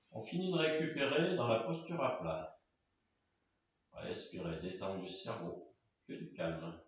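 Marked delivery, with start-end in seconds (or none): 3.9-6.8 low, very soft and sparse; hushed closing meditation cues; guided relaxation. Real, not acted. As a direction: lull them into deep rest. Guides final rest.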